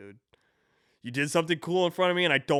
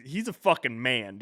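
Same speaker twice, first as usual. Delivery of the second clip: ordinary talk, nothing marked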